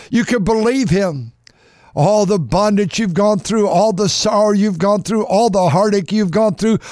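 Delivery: rhythmic preacher's build on "all"; preaching cadence. Acted. Preacher to congregation, rhythmic rising preaching. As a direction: build the list like a sermon.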